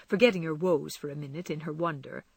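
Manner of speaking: ordinary delivery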